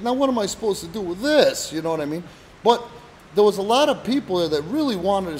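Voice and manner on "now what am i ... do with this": sarcastic. Retold or imitated. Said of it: "he would get sarcastic and be like".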